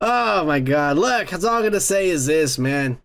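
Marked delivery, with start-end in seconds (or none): none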